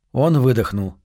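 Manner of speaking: plain reading voice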